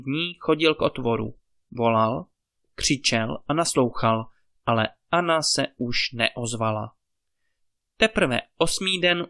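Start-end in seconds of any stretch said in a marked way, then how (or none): none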